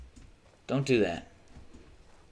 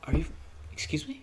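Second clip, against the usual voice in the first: incredulous excuse me; 3 semitones higher, 7 dB quieter than usual. Retold or imitated. Retold reaction to channel deletion.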